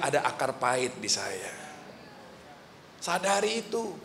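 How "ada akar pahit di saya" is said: a soft, humble admission; quiet confession. Shown as a demonstration. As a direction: own up gently and quietly. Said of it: "Bilang aja". (pengakuan pelan dan rendah hati)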